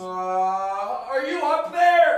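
high wife voice calling upstairs; calling from far away. Acted. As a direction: shout up to someone in another room.

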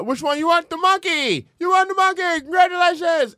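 high, loud booth pitchman shout; carnival game barker. Acted. Midway worker calling to players over the prizes, high barker shout. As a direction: hawk the prize loudly, way up in pitch.

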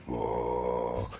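very low, very soft drawn-out whoa; a low rumble rising from inside; deep inner whoa. Retold or imitated. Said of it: "all of a sudden I hear".

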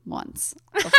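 ordinary talk, nothing marked

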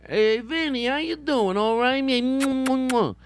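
high, loud, drawn-out New York Vinny greeting. Retold or imitated. Host greeting his girlfriend's brother Vinny, high and loud, hammed-up. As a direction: ham up a buddy greeting with a Jersey swagger. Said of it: "I have to say it once in a while".

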